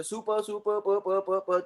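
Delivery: getting stuck mid-word; acted stumbling. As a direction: stutter on the syllable प. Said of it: "कोई आपसे बड़ा word आ गया".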